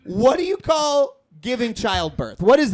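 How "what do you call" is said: high, loud indignant shout; patient yelling at the dental staff in pain; furious yell. Retold or imitated. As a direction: hurl it back at the staff. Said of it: "And I was like".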